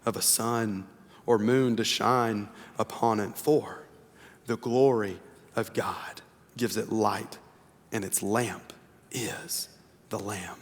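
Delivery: slow, soft scripture reading; hushed reverent reading. Acted. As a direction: read the promise gently and reverently. Reads scripture passage slowly.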